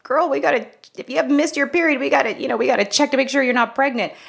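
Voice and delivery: high-pitched, insistent girlfriend talk, retold; urgent friendly nagging. To her college roommate about a missed period, insistent. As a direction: urge your roommate, voice pitched well up.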